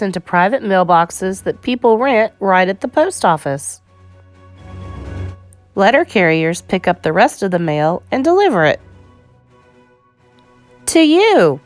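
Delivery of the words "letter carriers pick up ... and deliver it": ordinary delivery, nothing marked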